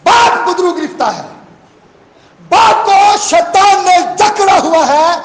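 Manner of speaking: loud, high, slow preaching shout; preaching at full force. Real, not acted. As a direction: hammer the point home to the church. Preacher's climax of the point. (پوری قوت سے بلند آواز میں واعظ)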